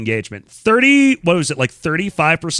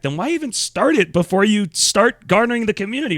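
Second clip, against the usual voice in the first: ordinary talk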